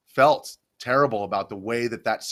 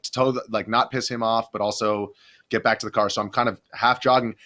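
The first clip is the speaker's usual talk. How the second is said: ordinary delivery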